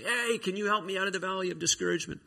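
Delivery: high-pitched calling up from below; mock yell. Man in the valley yelling to people up there, mock yell. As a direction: call for help without real volume. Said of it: "he'd yell at people up there".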